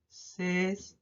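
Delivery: "césped" slow and low, syllables far apart; dictating while writing. Shown as a demonstration. Teacher writing the answer, "césped" slowly in pieces. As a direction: spell out "césped" by syllable as you write. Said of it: "voy a escribir aquí".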